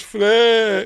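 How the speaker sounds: lightly acted, high drawn-out call. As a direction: call her name in mock distress. Wounded man calls to her.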